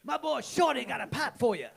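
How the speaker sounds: high, loud New Orleans street voice; Shorty's New Orleans accent. Shown as a demonstration. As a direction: repeat the line in a hammed-up New Orleans accent. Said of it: "could you do that voice again?".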